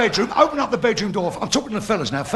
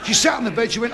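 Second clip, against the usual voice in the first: ordinary talk, nothing marked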